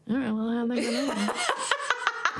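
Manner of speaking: garbled words round a horse's bit; mock gagged talk, close to laughing; bit in mouth. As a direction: mumble it comically with your mouth wedged open. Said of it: "there's a bit in my mouth".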